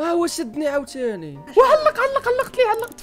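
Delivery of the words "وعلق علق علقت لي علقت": excited. Real, not acted. Stuck, high laughing complaint.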